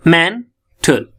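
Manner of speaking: "Mental" slowly, rising on men, falling on tal; first-syllable stress, split. Shown as a demonstration. Teacher drilling primary stress in "mental", slow and split. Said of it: "मेन, इसलिए पहली stress".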